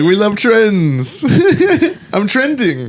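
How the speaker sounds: high, slow and laughing. Retold or imitated. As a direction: squeal it out through laughter. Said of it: "we're all like".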